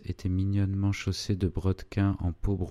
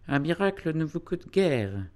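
high put-on lady's voice; high-pitched, affected politeness; 9 semitones higher than usual. A narrator's reading. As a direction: pay a showy compliment in a high voice. Madame Crémière continues her affected politeness.

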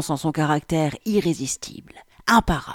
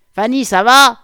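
loud, raised man's shout from above; calling out; 7 semitones higher, 12 dB louder than usual. A narrator's reading. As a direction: shout down to check on a colleague. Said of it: "criait une voix d'homme au-dessus de nous". (crier de loin, fort et plus aigu)

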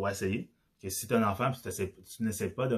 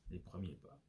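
softly spoken, much quieter; quiet confirmation; 2 semitones lower, 15 dB quieter than usual. Real, not acted. Host confirming the topic to listeners, quietly. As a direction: drop the volume right down, matter-of-fact.

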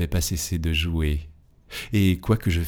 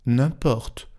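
a pouting, higher dismissive reply; sulky; 6 semitones higher than usual. A narrator's reading. Angela shrugging off the clock's warning, pouting. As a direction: brush it off like a sulking girl. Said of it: "d'un ton boudeur".

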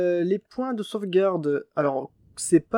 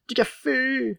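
excited; 8 semitones higher, slower than usual. Acted. Repeats word higher.

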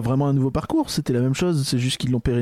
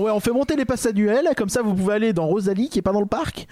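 higher-pitched imitation of a sales pitch; mock announcement; 7 semitones higher than usual. Co-host parodies the park pushing annual passes, mock announcement. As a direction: lightly mimic the park's spokesperson. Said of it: "T'es en train de dire".

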